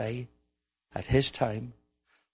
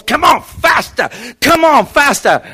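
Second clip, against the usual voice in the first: high, loud, stretched yell; hammed-up charioteer's cry; urging shout; 13 semitones higher, 13 dB louder than usual. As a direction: drive the horse on at full voice. Charioteer urging on his horse.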